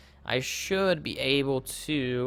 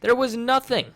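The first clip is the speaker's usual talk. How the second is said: higher, louder exasperation; exasperated disbelief; 7 semitones higher, 4 dB louder than usual. Real, not acted. Player ranting about the ruined base, exasperated. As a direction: vent the disbelief.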